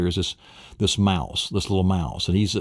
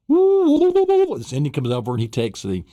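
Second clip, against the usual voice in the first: loud storytelling; 6 semitones higher, 4 dB louder than usual. A narrator's reading.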